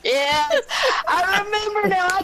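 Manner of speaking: high-pitched, laughing excitement; laughing recognition. Real, not acted. Shared laughing recall of joke.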